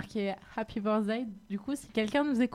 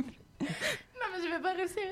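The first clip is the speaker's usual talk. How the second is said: squeaky, much higher helium voice; 9 semitones higher, 6 dB quieter than usual. Real, not acted. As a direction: talk high and quiet after breathing helium. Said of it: "[name] prend la parole à l'hélium".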